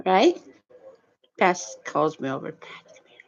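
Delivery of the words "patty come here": whisper. Retold or imitated. Friend secretly calling her over.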